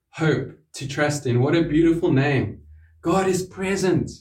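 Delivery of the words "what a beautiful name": normal talking voice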